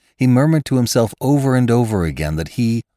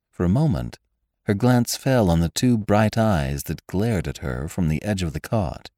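ordinary delivery, nothing marked

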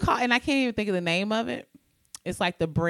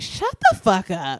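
dismissive; 4 dB louder, slower than usual. Retold or imitated. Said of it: "And I'd be like".

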